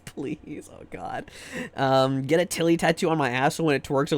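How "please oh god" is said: unvoiced whispered plea. Real, not acted. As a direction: breathe it out in a whisper. Begging aside after laugh.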